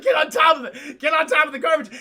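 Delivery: loud, high shouted order; urgent yelling. Retold or imitated. Han to the others in the compactor, urgent shout. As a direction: yell it like an emergency command.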